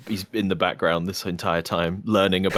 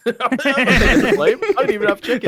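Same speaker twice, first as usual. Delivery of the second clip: laughing; 13 semitones higher, 6 dB louder than usual. Real, not acted. Laughing protest at the blame.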